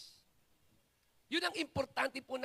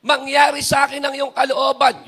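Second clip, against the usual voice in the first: proclaimed prayer; 16 dB louder than usual. Retold or imitated. Quoted surrender prayer, shouted.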